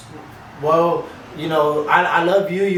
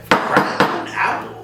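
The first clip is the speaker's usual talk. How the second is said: ad announcer; much slower than usual. Pretend. Sponsor shout-out.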